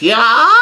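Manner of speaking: high, drawn-out comic squeal; startled yelp. Retold or imitated. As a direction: let out a loud, rising yelp. Imitates Brule's funny startled face.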